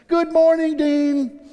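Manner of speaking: high, loud sing-out hello; cheery nurse greeting. Retold or imitated. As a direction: imitate a chirpy morning greeting. Day nurse's cheery greeting acted.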